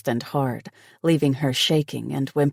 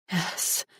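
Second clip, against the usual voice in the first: gasped hoarsely; 4 semitones higher, 6 dB quieter than usual. A narrator's reading. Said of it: "she gasped hoarsely".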